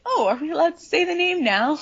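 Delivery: high, airy innocent question; dreamy Luna. Retold or imitated. Luna asking the group, high and innocent. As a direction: ask it with wide-eyed innocence. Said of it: "Luna's like".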